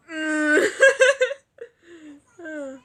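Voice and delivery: loud, high burst of laughter; big laugh. Real, not acted. Reacting to what is on screen, loud helpless laughter. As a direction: crack up hard and loud.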